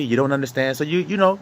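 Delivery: normal talking voice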